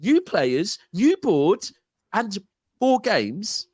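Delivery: high, slow, disbelieving emphasis; incredulous. Real, not acted. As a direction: spell out how absurd it is. Incredulous listing.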